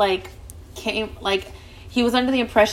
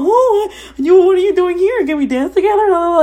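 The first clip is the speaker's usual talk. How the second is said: high ditzy flirty voice, much higher and louder; mocking her own flirting; 9 semitones higher, 6 dB louder than usual. Narrator mocks her own flirting with the married man, high ditzy voice. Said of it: "I look so fucking dumb".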